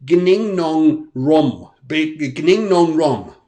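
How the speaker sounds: "Gning Nong Rom" spelled slowly, syllable by syllable; reading mug backwards. Shown as a demonstration. Reading his mug backwards to viewers, slow and loud. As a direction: sound out "Gning Nong Rom" like a strange foreign phrase. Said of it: "this is supposed to say".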